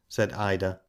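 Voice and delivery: plain reading voice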